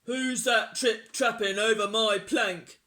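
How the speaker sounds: loud, gruff pirate taunt; jeering. A narrator's reading. Pirate chief taunts troll on plank, jeering. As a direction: jeer at full volume. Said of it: "jeered Hank Chief".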